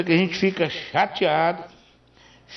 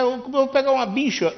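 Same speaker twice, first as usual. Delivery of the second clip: put-on Portuguese accent; 8 semitones higher than usual. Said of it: "se tu disser que vai pegar uma bicha".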